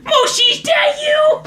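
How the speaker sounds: high, slow mimicked holler; crying out. Man calls out one last time, high shouted cry. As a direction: imitate a man's drawn-out call. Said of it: "I heard cry out".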